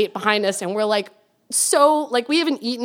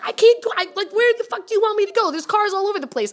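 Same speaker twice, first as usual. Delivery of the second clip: much higher, frantic snapping back; retold angry yell; 9 semitones higher, faster than usual. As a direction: yell back, cornered and fast. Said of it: "we're, like, screaming at each other".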